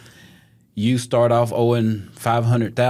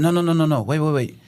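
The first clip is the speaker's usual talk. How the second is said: urgent no; 6 semitones higher than usual. Retold or imitated. Urgently stopping caller from walking away.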